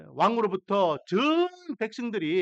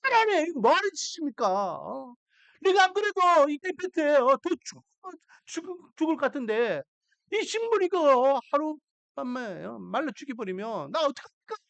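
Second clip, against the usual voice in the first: Jonah complaining; 8 semitones higher than usual. Acted. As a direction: whine at God over the dead plant. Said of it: "불만과 불평을 합니다". (식물이 죽자 하나님께 따지는 요나, 높고 큰 불평)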